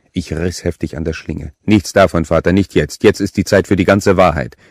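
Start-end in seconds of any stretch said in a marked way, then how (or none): 1.6-4.6 loud, cutting order; harsh command. A narrator's reading. Killer stopping the priest's prayer, loud command. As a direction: cut off his prayer hard.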